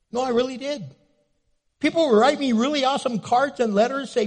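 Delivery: usual speaking voice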